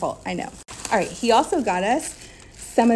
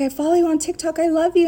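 bright excited fan voice; gushing fan; 9 semitones higher, 4 dB louder, faster than usual. Retold or imitated. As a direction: gush like a happy fan. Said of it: "She was like".

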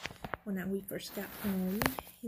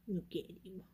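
soft whisper to the cat; 9 dB quieter, slower than usual. Real, not acted. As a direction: murmur tenderly to a pet.

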